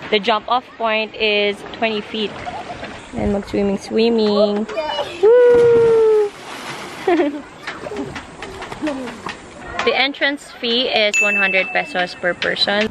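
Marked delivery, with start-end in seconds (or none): 5.2-7.4 loud high whoop into a laugh; excited whoop. Real, not acted. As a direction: whoop as you leap. Jumping off the cliff.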